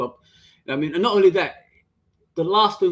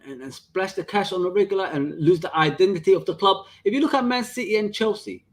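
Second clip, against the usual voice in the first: ordinary delivery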